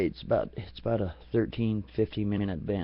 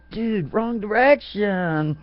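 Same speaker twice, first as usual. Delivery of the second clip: much higher, louder, very slow; correcting the vampire firmly; high, drawn-out mock scold; 10 semitones higher, 6 dB louder, much slower than usual. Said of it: "I said".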